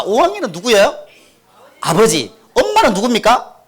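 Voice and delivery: normal talking voice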